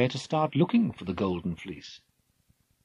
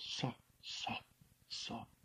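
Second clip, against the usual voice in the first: hushed fading whisper; 18 dB quieter, slower than usual. A narrator's reading. Narrator describing the fleece, whispered. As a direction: breathe the word out softly.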